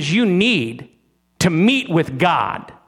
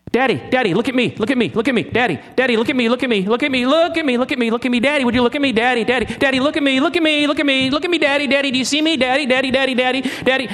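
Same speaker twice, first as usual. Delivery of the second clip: put-on higher, pleading child begging daddy for attention; whiny child; 7 semitones higher, much faster than usual. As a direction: whine like a kid tugging at a sleeve. Mocking needy child praying.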